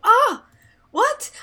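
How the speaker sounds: high startled yelp; shocked, loud and high; surprised. Real, not acted. Shocked he watched her show.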